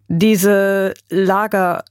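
very drawn-out words, slowly begun; heavy, halting start. A narrator's reading. Grandfather begins to explain the camps, heavy and slow. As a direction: begin haltingly, stretching each word. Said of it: "seufzte so tief".

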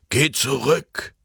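slow, insistent order; an old man ordering him back; lightly voiced old man giving a stern command. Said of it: "befahl der Alte".

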